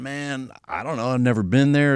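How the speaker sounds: usual speaking voice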